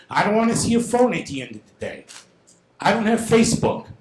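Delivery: loud, heated scolding; angry. Real, not acted. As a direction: shout down the council with frustration. Angry resident scolding the council.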